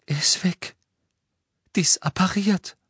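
high, upset voice, halting; distraught. A narrator's reading. Hermine telling Harry that Ron is gone, high distraught voice. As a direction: break the news in distress. Hermine returns after sobbing.

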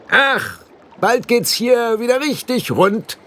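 high, eager little blue man; squeaky fairy barking an order; high pixie voice. A narrator's reading. Pixie chief urging the boy to run, high eager voice. Rob Anybody grinning.

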